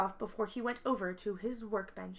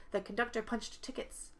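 plain reading voice

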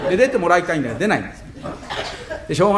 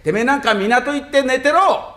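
mock tellng-off; 8 semitones higher, slower than usual. Yelling at the fat man.

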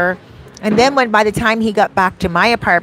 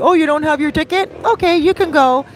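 mock careless driver; 8 semitones higher, faster than usual. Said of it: "they're not just gonna be like".

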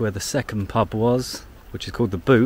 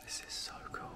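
whisper; 19 dB quieter, faster than usual. Real, not acted. Quiet churchyard.

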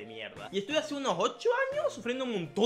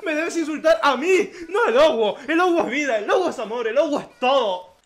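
higher, louder hammed-up scolding; loud pretend telling-off; mock indignation; 6 semitones higher, 5 dB louder than usual. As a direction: defend it with theatrical outrage. Mock scolding in defence of logo.

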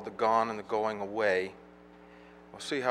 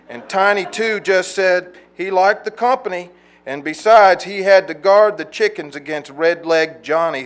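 louder, higher storytelling recital in rolling phrases; poetry reading; 6 semitones higher, 13 dB louder than usual. Acted. Poet tells an audience of old hollow folk, rhythmic poetry reading. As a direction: recite it as a folk tale in verse. Reads a poem aloud.

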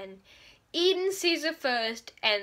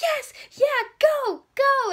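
much higher, loud cheering; excited cheer; 12 semitones higher, 6 dB louder, slower than usual. Retold or imitated. Reader cheering as the hero wakes, excited. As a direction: cheer like a fan. Cheering the news that Day woke.

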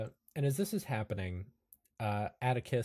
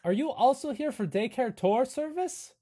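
high, eager hunchback host; Igor voice; 11 semitones higher, 4 dB louder than usual. Acted. Igor invites the newcomers on the tour, high. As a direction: usher the visitors along.

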